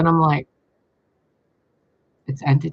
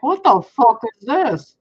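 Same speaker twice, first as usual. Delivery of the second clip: higher, louder and slow, stretched out in disbelief; startled outburst; 10 semitones higher, 6 dB louder than usual. Retold or imitated. Reliving the salvia vision, a startled, stretched-out outburst. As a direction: blurt it out as if seeing it again.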